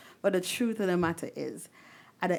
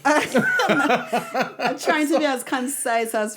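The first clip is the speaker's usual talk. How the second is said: laughing; 3 semitones higher, 7 dB louder than usual. Real, not acted. Guest laughs at wrap-up.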